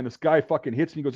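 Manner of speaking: ordinary delivery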